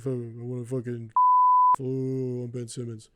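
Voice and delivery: lazy, dragged-out athlete impression; Ben Simmons. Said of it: "He's gonna be like".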